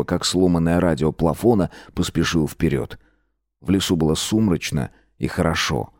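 plain reading voice

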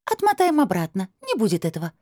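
high voice, brushing off objection; dismissive crystal voice. A narrator's reading. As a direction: wave it away from up high. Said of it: "хмыкнул голос". (высоким голосом, небрежно)

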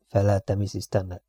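usual speaking voice

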